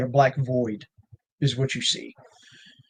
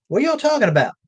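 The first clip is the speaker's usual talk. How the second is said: high-pitched puzzled question; retold question in a much higher voice; imitated call back; 12 semitones higher, 3 dB louder, faster than usual. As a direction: mimic a friend calling back, pitched way up. Friend calling back in a retold story.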